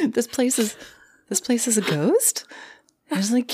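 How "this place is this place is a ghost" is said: high-pitched giddy disbelief, lightly acted; mock thrilled. Goth girls asking the manager about the ghost, thrilled. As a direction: imitate a delighted, disbelieving question.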